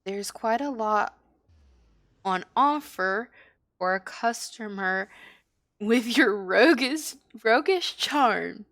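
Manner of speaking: obliging tavern host, lightly acted; lightly voiced barkeep. Barkeep to roguish customer about what's on offer, lightly in character. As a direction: offer your services as a barkeep. Reads barkeeper's dialogue in game.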